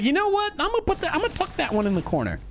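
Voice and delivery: high, smug player voice; squeaky mock player thought; voicing the player. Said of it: "he's like".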